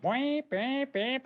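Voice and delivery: high bwamp bwamp like Mario jumping; jump sound effect. Pretend. Presenter imitating Mario jumping for viewers, high bwamp effect. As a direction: make the little jump noise.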